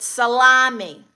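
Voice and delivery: loud, drawn-out Brooklyn accent. Shown as a demonstration. Streamer showing off her accent to chat, loud Brooklyn accent. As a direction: belt it out slowly in Brooklynese. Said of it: "It's a Brooklyn accent".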